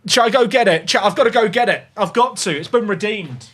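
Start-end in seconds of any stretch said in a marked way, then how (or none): none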